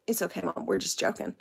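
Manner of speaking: low, soft imitated aside; quiet reassurance. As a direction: softly put your mother at ease. Daughter quietly reassures mother.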